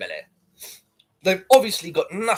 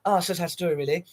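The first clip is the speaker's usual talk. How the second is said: ex-fiancée voice; 3 semitones higher, 4 dB quieter than usual. Retold or imitated. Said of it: "they'll be interviewing her".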